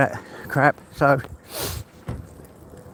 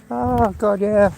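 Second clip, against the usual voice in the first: tearful; 7 semitones higher, slower than usual. Real, not acted. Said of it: "Crying like a baby at the moment".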